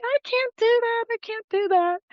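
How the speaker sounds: high-pitched mock panic; squeaked-up retold refusal; retold flustered protest. Retells panicked refusal, face beet red.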